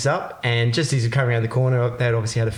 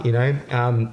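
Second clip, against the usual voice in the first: ordinary talk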